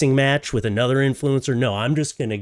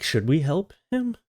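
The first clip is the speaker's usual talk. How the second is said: mock bystanders; 8 semitones higher than usual. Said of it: "So many people, like".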